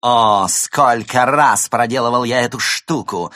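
loud high gloating voice; demon villain. Acted. Demon boasts on stage.

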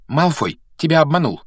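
butting in. A narrator's reading. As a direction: cut in to set him straight. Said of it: "встряла в разговор Гермиона". (настойчиво вмешиваясь в разговор)

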